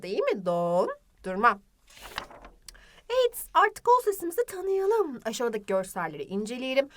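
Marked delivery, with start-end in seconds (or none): 0.5-1.6 "Dondurma" with long low "don", then short "durma"; stretched middle O. Shown as a demonstration. Teacher confirming O's position, long o in "Dondurma". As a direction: hold the o of "Dondurma", lower. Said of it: "Tabii ki de ortasında".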